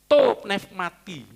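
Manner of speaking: "To pneumati" spoken very slowly, every syllable clear; slow Greek recitation. Shown as a demonstration. Pastor teaching the Greek phrase to the congregation, very slow. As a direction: spell out To pneumati slowly for learners. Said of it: "teaching Greek word slowly".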